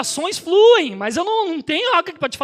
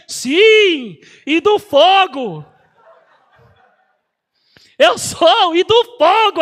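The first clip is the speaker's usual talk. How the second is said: louder, proclaiming he is of the fire; proud loud declaration; 6 dB louder, much slower than usual. Retold or imitated. Telling the nurse he's a pastor of fire, loud and proud. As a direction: boast it out, drawing out "fogo".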